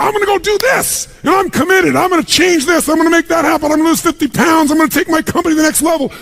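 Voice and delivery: high, shouted burst of excitement; pumped-up resolve. Retold or imitated. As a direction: fire yourself up like a new convert. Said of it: "you get out there and you go".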